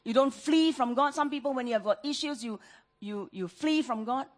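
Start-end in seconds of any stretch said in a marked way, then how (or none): none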